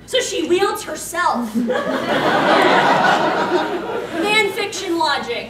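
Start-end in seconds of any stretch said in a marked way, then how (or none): 1.8-4.5 big unvoiced laugh; loud burst of laughter. Real, not acted. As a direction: crack up at the absurd logic. Laughing at fiction logic.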